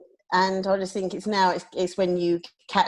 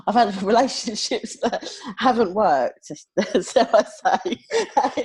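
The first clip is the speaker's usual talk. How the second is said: laughing; 6 semitones higher, slower than usual. Real, not acted. Laughs at awkward confession.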